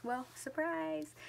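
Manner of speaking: high, soft, drawn-out mock surprise; wry aside. Real, not acted. Young mom answering her boyfriend's disbelief with a wry aside.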